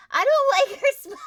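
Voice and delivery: high, loud, laughing unease; giggling worry; nervous laugh. Real, not acted. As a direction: laugh through the dread. Uneasy at villain's smile.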